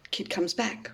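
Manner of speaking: ordinary talk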